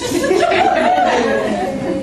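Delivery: high laugh. Real, not acted. Laughs at diaper story.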